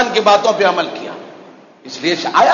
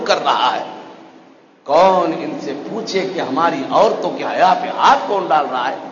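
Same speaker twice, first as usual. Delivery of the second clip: low, subdued rhetorical questioning; sorrowful reproach from the pulpit; grave preaching; 4 semitones lower than usual. Real, not acted. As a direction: let each question sink at the end.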